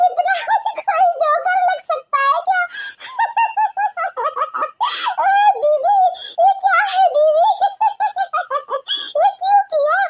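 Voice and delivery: mock her between laughs; laughing mockery. Mocking the wife's joker look. (हँसते हुए ऊँची आवाज़ में मज़ाक)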